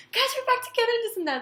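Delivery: high, fast, gushing; excited announcement. Retold or imitated. Announcing to friends they are back together, high and rushed. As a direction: squeal the news out fast.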